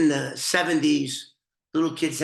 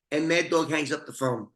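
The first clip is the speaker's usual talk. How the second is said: ordinary talk, nothing marked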